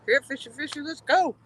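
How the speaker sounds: high sing-song coaxing call; calling a fish. Real, not acted.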